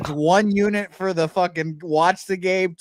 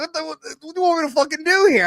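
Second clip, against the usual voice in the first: exasperated outburst; 8 semitones higher, much faster than usual. Retold or imitated. And the owner got mad at me.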